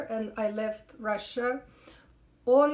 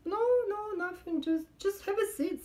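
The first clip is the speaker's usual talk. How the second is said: lightly voiced high officer; 9 semitones higher than usual. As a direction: voice the cop up high.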